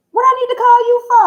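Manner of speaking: high, loud and fast, full of sass; sassy rhetorical question. Real, not acted. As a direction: toss it off like the idea is ridiculous. Sassy rhetorical question about men.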